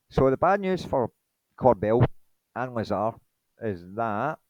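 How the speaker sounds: usual speaking voice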